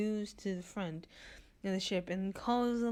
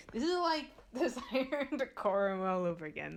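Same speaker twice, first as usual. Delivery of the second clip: ordinary talk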